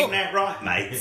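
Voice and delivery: hammed-up Aussie "mate" tease; Australian accent. [name] mocks the Australian friend in a high Aussie accent. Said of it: "Your Australian accent is worse".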